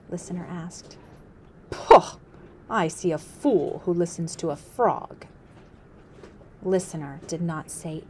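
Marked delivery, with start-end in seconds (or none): 1.7-2.2 sharp scornful pooh; loud scoff. Acted. Chief scoffs loudly. 2.7-5.3 a mocking chief, drawing each word; scornful chief. Chief mocks Listener.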